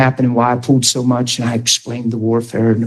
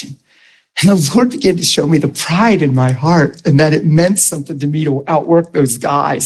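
ordinary talk, nothing marked

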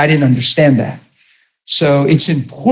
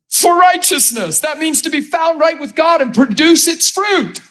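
fervent preaching; 11 semitones higher than usual. Real, not acted. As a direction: lift it up like a revival cry. Preacher climax, high pitch.

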